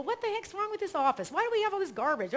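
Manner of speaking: high, fast mock complaint; light put-on indignation; mock exasperation. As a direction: play the puzzled grumbler. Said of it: "for most people".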